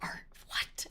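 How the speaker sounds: a toneless whispered aside. Real, not acted. Streamer puzzling over the clone, whispering to themself. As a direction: breathe it out barely voiced.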